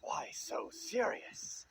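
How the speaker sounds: slow, menacing clown taunt; eerie, drawn-out Joker voice; creepy Joker. Acted. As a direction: draw it out like a sinister villain. Joker figure taunts the scared boy.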